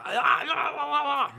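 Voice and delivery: high-pitched stammering wail; flustered babble. Acted. Husband cornered by livid wife, flustered babbling. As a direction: babble helplessly in mock panic.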